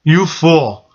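lightly voiced demon taunt; drawn-out taunt, imitating a gloating demon; a hint of demonic mockery; mocking demon. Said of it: "the voice of a demon said to me".

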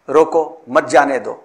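रोको alone, pause, then मत जाने दो together; pause after रोको. Shown as a demonstration. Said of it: "रोको पर तक-, तवक्कुफ बादशाह वक्त ने किया".

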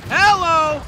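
a sudden loud, high hello. Real, not acted. Streamer yelling hello at the game. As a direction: call it out big and bright.

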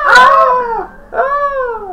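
high piercing death scream. Shown as a demonstration. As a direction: scream like you're dying. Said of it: "[name] death screams".

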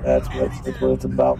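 deep monotone voice-changer robot. Shown as a demonstration. Streamer asking squad how the mod sounds, robot voice. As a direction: drone it out on one very low note. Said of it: "able to sound like a robot".

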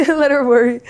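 laughing repeat of the line. Real, not acted. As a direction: crack up while repeating it.

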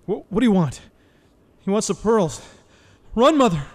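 high, loud panicked shouts; a scared boy shouting for his mother to run; frantic shouting. Acted. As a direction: yell in panic to save your mother.

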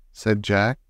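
ordinary delivery, nothing marked

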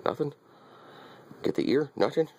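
high coaxing. Real, not acted.

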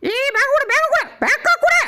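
high-pitched, insistent plea; frantic demand. Acted. Demanding the bag be handed back, frantic.

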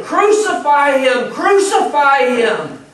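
slow, high, loud crowd chant; acted shouts demanding an execution; mob shouting. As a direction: shout it like an angry mob, drawn out.

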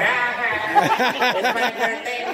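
put-on high, sing-song party girl; birthday girl partying. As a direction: show off like it's your birthday all month. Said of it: "the females like to celebrate".

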